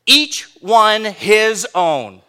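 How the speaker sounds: EACH and HIS punched, every word set apart; slow, weighty stress on each word; emphatic, spaced-out words. Shown as a demonstration. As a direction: hammer "Each one his own" slowly. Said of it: "Big emphasis on individualism here".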